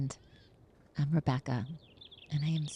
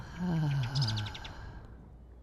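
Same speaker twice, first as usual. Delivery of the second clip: audible exhale. Shown as a demonstration. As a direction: let a long breath fall out audibly. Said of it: "audible exhale".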